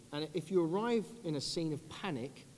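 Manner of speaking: normal talking voice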